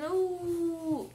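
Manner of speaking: NU with a held N sliding into a long U; nnnuuu, drawn out; blending letter sounds. Shown as a demonstration. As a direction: hum the N, then open to "Nu". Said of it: "vamos falar o sonzinho da letrinha N".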